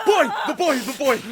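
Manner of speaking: put-on high, urgent cry of the boy; urgent shout. Narrator cries out to stop the knife, urgent shout.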